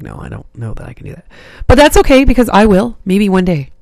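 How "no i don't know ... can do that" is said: soft, quick defeated murmur. Retold or imitated. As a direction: mutter it, giving up. Defeated inner voice quoted.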